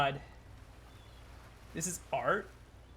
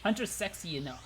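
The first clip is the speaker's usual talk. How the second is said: normal talking voice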